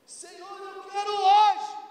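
high, drawn-out begging to the Lord; pleading believer. Acted. Believer begging the Lord, high and whiny. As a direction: whine up at God like a needy child.

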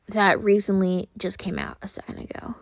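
soft unvoiced whisper, half to herself; whispered aside. Real, not acted. Podcaster confiding to listeners that the song is brand new, whispered. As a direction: let it drop into a hush.

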